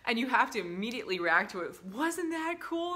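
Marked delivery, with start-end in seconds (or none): 1.9-2.9 high, slow, bright praise to a little patient; upbeat cheer for kid. Retold or imitated. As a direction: sell it so the child won't cry. Cheering up a scared child.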